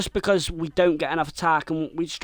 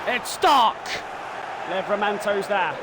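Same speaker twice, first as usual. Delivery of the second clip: higher, upbeat commentary read; live play-by-play; 6 semitones higher than usual. Acted. Gamer commentating the replay, upbeat play-by-play. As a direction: keep the commentator energy going.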